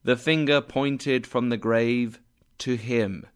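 plain reading voice

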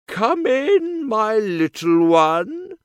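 high, loud sweet granny voice; wolf squeaks like grandma; wolf playing grandma. A narrator's reading.

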